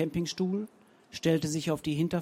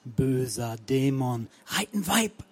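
slow, lower chanting curse; shaman incantation; 3 semitones lower than usual. A narrator's reading. Nana Mobango chants against the demon, slow and low. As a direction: ward off the demon.

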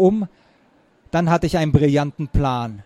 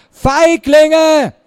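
loud, high drawn-out jeer; full-voiced challenge; shouted taunt; 10 semitones higher, 8 dB louder than usual. A narrator's reading. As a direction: yell the insult after them. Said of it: "Lionel rief".

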